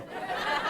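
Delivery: a short, breathy laugh under the breath; stifled breathy laugh. Real, not acted. Recalling fooling his girlfriend, a stifled laugh. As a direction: let a quiet laugh slip out. Laugh after the feigned innocence.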